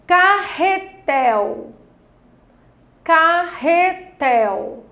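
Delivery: CARRETEL very slow, in separated syllables, TEL stressed; syllable stress demo. Shown as a demonstration. Teacher quizzes children on the tonic syllable, Carretel stretched with stress on TEL. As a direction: sound out Carretel, holding TEL long. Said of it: "ler com maior intensidade a palavra".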